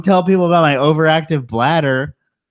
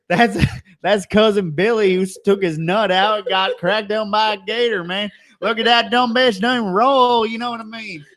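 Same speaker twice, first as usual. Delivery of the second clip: hillbilly voice; 6 semitones higher than usual. Pretend. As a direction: ham up a rowdy country cousin. Voices redneck cousin Billy character.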